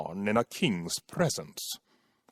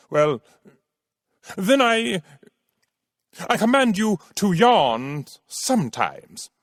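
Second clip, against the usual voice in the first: sputtering, annoyed; 7 semitones higher than usual. Acted. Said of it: "He was sputtering a little and seemed annoyed".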